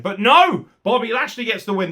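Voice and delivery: a loud, high joyful "no!"; excited. Real, not acted. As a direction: burst out with delighted surprise. Surprise at result.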